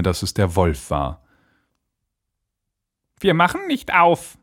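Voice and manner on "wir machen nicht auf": high, put-on little goat voice; defiant goat kids. A narrator's reading. Goat kids refuse the wolf at the door, defiant high voice.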